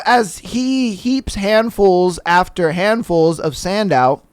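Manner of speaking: normal talking voice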